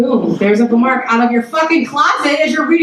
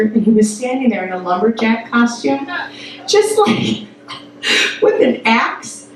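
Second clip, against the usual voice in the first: ordinary delivery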